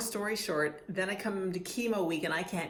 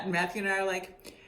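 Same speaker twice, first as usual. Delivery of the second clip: normal talking voice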